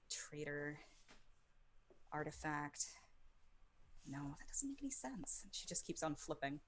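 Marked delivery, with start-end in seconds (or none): 0.1-5.4 soft, halting mutter between pauses; hushed, puzzled talking to oneself; muttering to herself. Acted. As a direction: mutter distractedly over the cards. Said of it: "sort of muttering to herself".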